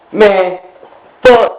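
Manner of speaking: normal talking voice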